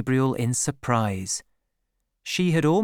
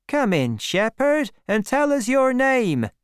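high-pitched villager calling out; welcoming villager; 11 semitones higher, 3 dB louder than usual. A narrator's reading. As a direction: hail the newcomer, pitched well up. Villager calls the newcomer in.

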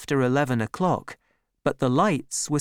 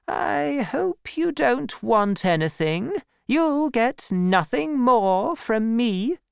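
put-on much higher, slow cold old lady; Miss Havisham; 11 semitones higher, slower than usual. A narrator's reading. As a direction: coldly dismiss the boy. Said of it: "said Miss Havisham".

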